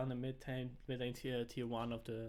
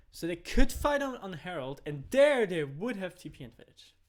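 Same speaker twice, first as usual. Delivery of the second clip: excited; 5 semitones higher, 11 dB louder than usual. Real, not acted. Hyped analysis of fight.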